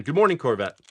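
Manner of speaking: normal talking voice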